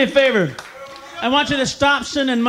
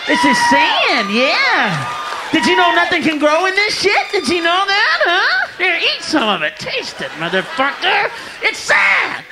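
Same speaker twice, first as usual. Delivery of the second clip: furious aid worker; 10 semitones higher than usual. Retold or imitated. Aid worker yelling at villagers about the desert, furious high shout. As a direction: hammer the point home with a yell.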